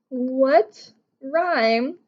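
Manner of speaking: usual speaking voice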